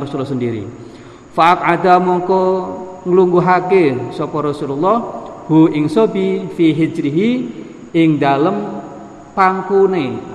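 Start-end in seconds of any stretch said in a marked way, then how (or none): 1.3-10.3 slow, measured reading aloud of scripture with gloss; intoned word-by-word text reading; kitab recitation. Acted. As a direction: draw out each phrase in a chanted recitation.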